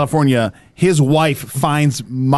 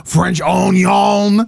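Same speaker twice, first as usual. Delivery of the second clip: very drawn-out French catchphrase; French accent; much slower than usual. Shown as a demonstration. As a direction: stretch it like a caricature Frenchman. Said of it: "do one in a French accent".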